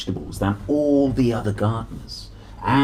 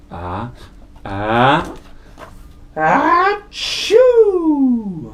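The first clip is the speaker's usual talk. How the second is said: building sneeze; 10 semitones higher, 7 dB louder, much slower than usual. Acted. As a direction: wind up and let the sneeze burst. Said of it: "the dust began to tickle Jack's nose".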